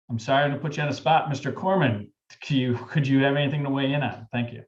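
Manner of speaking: ordinary talk, nothing marked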